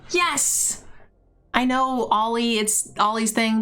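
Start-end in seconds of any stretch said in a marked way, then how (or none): 0.1-0.8 excited cheer. Real, not acted. Cheering after the howl.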